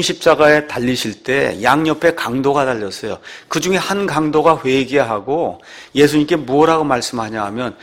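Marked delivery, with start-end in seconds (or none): none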